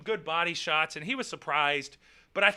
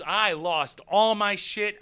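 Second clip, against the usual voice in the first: ordinary delivery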